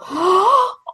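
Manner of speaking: a sharp, high intake of breath; high gasp. Retold or imitated. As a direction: gasp as if everything is too much. Acting out being overwhelmed.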